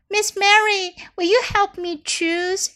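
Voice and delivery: put-on eager little girl. Clara asks eagerly.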